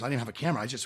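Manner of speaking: ordinary talk, nothing marked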